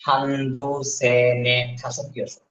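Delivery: হান্দু সেনে শাসক drawn out slowly, each word apart; slow drill. Shown as a demonstration. Teacher drilling Korean numbers slowly for students. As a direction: count slowly so students can follow. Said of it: "counting drill".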